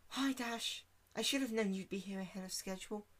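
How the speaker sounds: high, soft, gentle pony voice; shy pony greeting her friend softly; Fluttershy voice. Acted.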